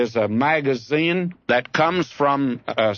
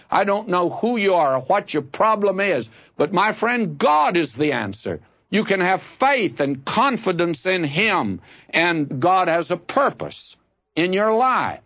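full-force preaching; 6 semitones higher than usual. Acted. Preacher urges his congregation to trust God, full force. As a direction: pour it out like a revival preacher.

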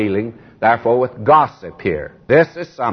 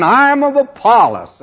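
mocking boaster; 9 semitones higher, 3 dB louder than usual. Mocking boastful church members.

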